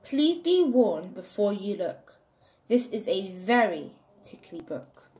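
ordinary delivery, nothing marked

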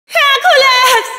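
high drawn-out cry of his name, slow; desperate shout. Acted. Meg cries out to Hercules in desperate alarm. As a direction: call him, stretching the name in alarm.

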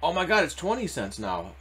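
normal talking voice

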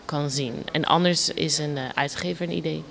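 ordinary talk

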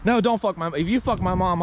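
ordinary talk

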